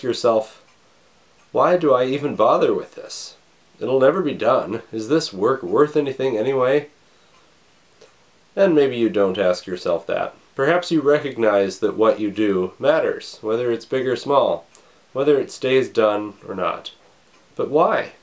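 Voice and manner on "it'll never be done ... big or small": usual speaking voice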